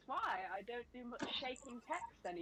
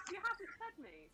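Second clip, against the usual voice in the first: cat's complaint; 10 semitones higher than usual. Retold or imitated. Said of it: "they're like".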